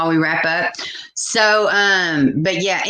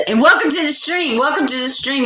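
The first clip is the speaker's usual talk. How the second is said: bright, higher welcome to viewers; stream host greeting; 10 semitones higher than usual. Acted.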